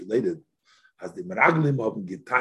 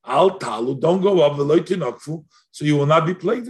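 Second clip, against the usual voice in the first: louder, urgent warning; 4 semitones higher, 5 dB louder than usual. Retold or imitated. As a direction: warn them not to go up, a bit louder. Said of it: "Moshe Rabbeinu warned".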